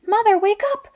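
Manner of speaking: high, eager whispered squeal. Acted. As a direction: squeal it with excitement. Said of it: "squealed Alfie".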